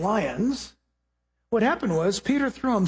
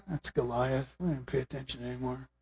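bored soldier; 9 semitones lower, 11 dB quieter than usual. Retold or imitated.

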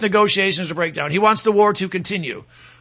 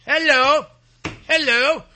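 sarcastic hello; 6 semitones higher, slower than usual. Acted. Sarcastic hello after Obama.

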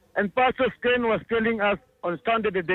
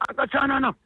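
retold scream; 5 semitones higher than usual. Child spotting the walker from a distance, mild mock scream. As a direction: imitate a child calling from afar, pitched up. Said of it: "from a distance she screamed".

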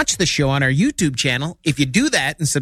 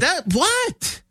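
surprised; 13 semitones higher than usual. Real, not acted. Caught out, mock surprise.

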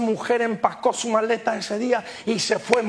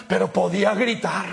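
ordinary talk

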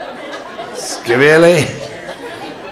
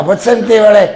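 put-on high, loud drunken bellow; rowdy drunk; 9 semitones higher, 5 dB louder than usual. Second drunk yelling at his friend, high drunken shout. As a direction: holler across the bar to your pal.

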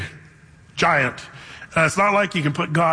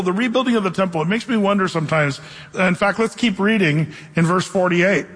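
ordinary talk, nothing marked

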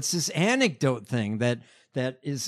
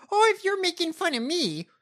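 high, mock-indignant whine of a candidate; high-pitched send-up of her complaint; mocking the politician; 12 semitones higher than usual. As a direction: mimic her taking offence, pitched way up. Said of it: "Like, she's like".

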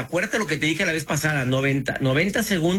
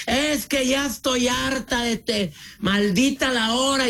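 angry outburst; 8 semitones higher than usual. Acted. Fed-up relative snapping at family, angry outburst. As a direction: blow up at a loved one, pitched way up. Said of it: "y gritando".

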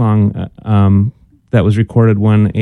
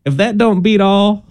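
put-on southern accent; 7 semitones higher than usual. Cohost trading old sayings, hammed-up southern accent. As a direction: play a folksy Southern lady. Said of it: "Why is your voice getting southern sounding".

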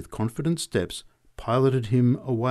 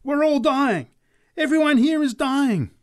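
high, loud prophetic scream; 7 dB louder than usual. A narrator's reading. Preacher screaming her prophecy at the crowd. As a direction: shriek the doom at the crowd. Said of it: "raised her voice to a scream".

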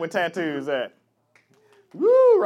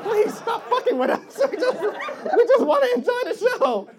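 very high, loud laughing whine; whiny pleading crowd; 7 dB louder than usual. Retold or imitated. Crowd begging the comic to stop, high laughing whine. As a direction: whine high like a fed-up fan. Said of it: "they're just like".

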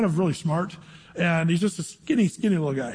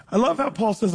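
normal talking voice

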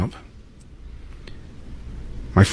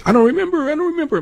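much higher, strained mock voice; imitating Trump's mock of a reporter, pitched way up; 3 dB louder, faster than usual. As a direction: ham up a flustered mocking squeal. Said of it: "mocking the journalist and waving his arms around".

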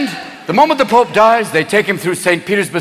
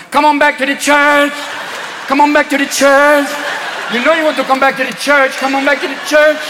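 higher-pitched rhythmic chant; samba nuns; 4 semitones higher than usual. Acted. Samba nuns luring people back to church, higher rhythmic chant. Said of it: "samba nuns in the thongs going".

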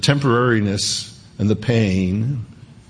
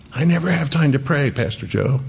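whiny excuse; 5 semitones higher than usual. Retold or imitated. Congregant excuse, mimicked.

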